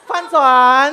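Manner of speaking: loud, slow call of 翻船 as the boat tips; playful loud announcement; dramatic call. Pretend. Boat game, pretend capsize.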